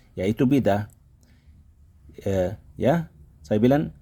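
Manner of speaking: ordinary talk, nothing marked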